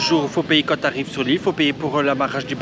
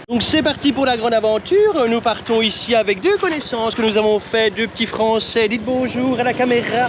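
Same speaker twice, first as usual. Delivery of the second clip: higher, louder host voice; excited vlog host; 8 semitones higher, 3 dB louder than usual. Acted. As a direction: hype up the start like a presenter. Launching the day's adventure.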